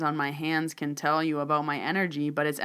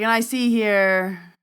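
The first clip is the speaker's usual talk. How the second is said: slow, louder, drawn-out divination; palm reader's reveal; 5 semitones higher, 7 dB louder, slower than usual. Acted. Palm reader about to reveal more to the man, slow mock suspense. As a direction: stretch it out for suspense.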